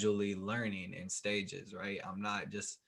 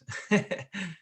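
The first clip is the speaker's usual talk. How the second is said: short bright laugh; a quick laugh out loud; 10 semitones higher, 4 dB louder than usual. Real, not acted. Host laughs about tiredness.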